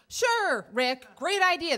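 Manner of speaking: high, loud fake-keen agreement; sarcastic enthusiasm. Rider answering her date's unwelcome invite, sarcastically eager. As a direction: agree far too brightly while hating the plan.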